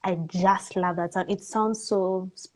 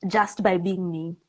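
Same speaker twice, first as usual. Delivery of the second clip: ordinary delivery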